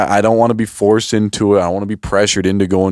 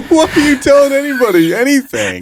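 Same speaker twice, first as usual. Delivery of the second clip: high-pitched, cracking up; helpless high laughing voice; laughing incredulity; 3 dB louder than usual. Real, not acted. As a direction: squeal the question through laughter. Mocking the man, breaking into laughter.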